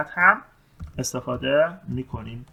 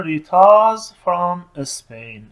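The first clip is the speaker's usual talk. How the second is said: very slow, stretched sentence; slow model answer; 4 semitones higher, 8 dB louder, much slower than usual. Shown as a demonstration. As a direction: read it slowly for learners. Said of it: "گفته". (جملهٔ کشیده و خیلی آهسته)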